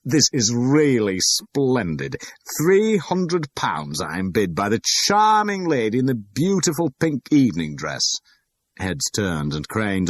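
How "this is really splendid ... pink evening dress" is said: loud, high, beaming announcement; triumphant auctioneer. A narrator's reading. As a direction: crow over the winning bid. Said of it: "he said triumphantly".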